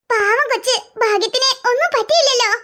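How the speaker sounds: put-on an indulgent grandma taking the boy's side; grandmother defending. Grandmother consoles.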